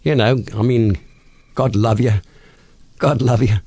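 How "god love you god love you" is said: chirpy London Cockney patter; Cockney accent. Shown as a demonstration. Host demonstrating British accents to interviewer, Cockney. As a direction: play a Cockney man dishing out homely advice. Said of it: "And then there's a Cockney man".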